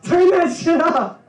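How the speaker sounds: high, loud laughing mock scream; furious kid yell. Kid yelling at brother over classical music, furious. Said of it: "I'd be".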